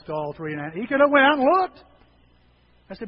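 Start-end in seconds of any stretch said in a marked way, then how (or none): 0.9-1.8 louder preaching emphasis; emphatic. Real, not acted. Pastor telling bride that Nick could have looked elsewhere. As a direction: drive the point home loudly. Pastor stressing Nick's choice.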